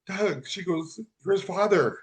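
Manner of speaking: moved to tears retelling kind words; tearful. Real, not acted. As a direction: keep talking through real tears. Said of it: "I started crying like I am now".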